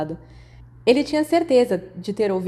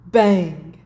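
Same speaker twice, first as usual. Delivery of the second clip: a loud "bang" imitating a firework; explosion bang; 6 dB louder than usual. A narrator's reading. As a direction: burst it out like a firework. (um estouro alto de foguete)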